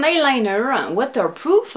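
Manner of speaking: put-on American accent on the English product name; playful American accent. Vlogger showing off an eyeliner for fun, playful American accent. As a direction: say it like a bilingual American, playfully exaggerated. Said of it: "j'aime bien faire l'accent américain".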